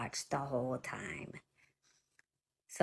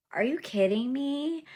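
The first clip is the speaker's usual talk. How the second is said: high-pitched, indignant disbelief; real incredulous challenge; 8 semitones higher, 4 dB louder than usual. Real, not acted. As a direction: throw it back at them, pitched way up. Rebuking commenters who suggest visiting with covid.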